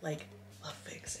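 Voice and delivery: soft breathy whisper, slow; whispered emphasis. Real, not acted. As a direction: whisper it slowly. Repeats line, second whispered.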